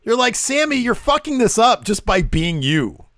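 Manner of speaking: high, drawn-out exasperation; worked-up viewer. Real, not acted. Fan on edge watching the segment, high and worked up. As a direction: pitch it up, fretting at the character. Reliving the tension of the scene.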